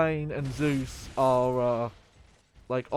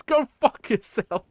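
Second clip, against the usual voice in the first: slow, loud and higher, spat back; the carjacked guy telling McClane off; deadpan carjack victim; 8 semitones higher, 6 dB louder than usual. Retold or imitated. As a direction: fire it back slowly with contempt. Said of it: "just goes".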